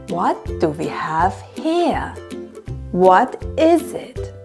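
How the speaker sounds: ordinary talk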